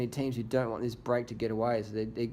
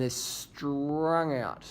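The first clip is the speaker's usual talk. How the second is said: slow, with "strung" dragged out like the line of riders; long rolled-out "strung"; drawn-out STRUNG; 2 semitones higher, much slower than usual. Real, not acted. Drawn-out word mirrors stretched peloton.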